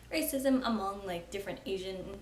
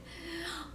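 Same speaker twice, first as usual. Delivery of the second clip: laugh; 8 semitones higher, 7 dB quieter than usual. Real, not acted. Laugh after self-deprecating line.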